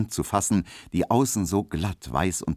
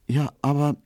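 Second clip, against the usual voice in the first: faltering, raised-pitch start; hesitant; 9 semitones higher than usual. A narrator's reading. Pauline, taken aback by Emil's tone, hesitant. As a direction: begin and break off, unsure. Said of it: "meinte sie stockend".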